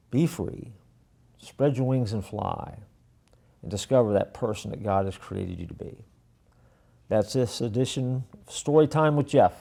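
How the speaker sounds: ordinary delivery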